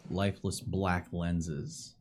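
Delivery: slow, low eerie description; ominous narration. A narrator's reading. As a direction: draw it out darkly.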